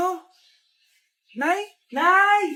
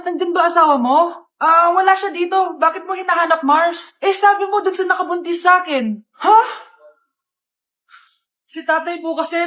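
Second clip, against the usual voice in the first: ordinary delivery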